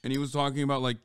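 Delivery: dismissive aside. Real, not acted. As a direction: mutter it off-mic, not caring. Streamer dismissing clip, turned away.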